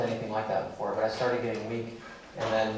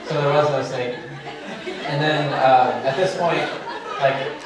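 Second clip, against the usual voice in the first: louder words breaking with laughter; laughing while talking; 3 semitones higher, 7 dB louder than usual. Real, not acted. Telling the audience the punchline, still laughing. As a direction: talk through the giggles.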